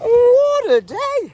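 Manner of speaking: exasperated exclamation. Real, not acted. Vlogger sighing out the day's chaos, high and slow. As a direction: throw your hands up at the whole day.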